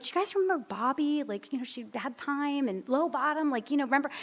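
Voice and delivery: high, soft, pleading for recognition; eager asking. Retold or imitated. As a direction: urge them to remember her. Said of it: "I'd be like".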